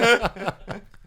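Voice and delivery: loud, high laugh burst; sudden high cackle; real laugh. Real, not acted. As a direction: burst out laughing mid-thought. Breaks up while debating.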